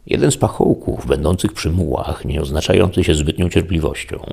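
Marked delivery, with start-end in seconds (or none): none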